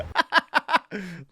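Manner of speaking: high, loud belly laugh; helpless high cackle of amusement; real burst of laughter. Real, not acted. Cracks up at the clip.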